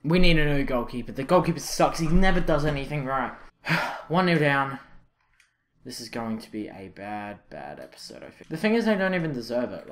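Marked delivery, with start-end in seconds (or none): 0.0-4.8 loud, frustrated outburst; real annoyance, raised voice; exasperated rant. Real, not acted. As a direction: rail loudly at your useless teammate. Rants after conceding a goal.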